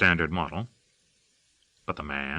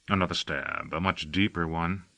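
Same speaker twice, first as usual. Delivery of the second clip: usual speaking voice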